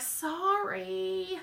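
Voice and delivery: high, drawn-out sorry; mock apology. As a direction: playfully plead sorry to a friend. Mock apology to friend's complaint.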